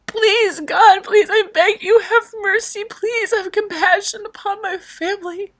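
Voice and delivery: high, sobbing prayer, begging loudly; crying plea. Retold or imitated. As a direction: plead with God through tears. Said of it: "And I was just screaming".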